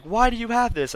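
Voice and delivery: high-pitched mock mom demand; imitating an alarmed mother. Mother's alarmed question, retold.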